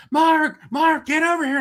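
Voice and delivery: high, loud urgent call; retold calling out. Friends summoning him to the scanner table, urgent calling. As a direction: imitate friends calling someone over.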